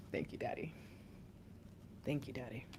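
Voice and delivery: very soft, low, private prayer; murmured thanks. Real, not acted. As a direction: murmur gratefully under your breath.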